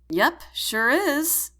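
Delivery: slow, loud, bright exclaim. Acted. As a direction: exclaim it cheerfully. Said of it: "I exclaim".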